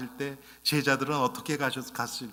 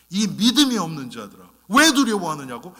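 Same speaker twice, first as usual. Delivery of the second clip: loud, rising scolding voice; stern rebuke; 4 semitones higher, 9 dB louder than usual. Acted. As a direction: rebuke the frightened disciples loudly. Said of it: "예수님 뭐라 그러세요? 책망을 하세요". (크게 꾸짖는 목소리)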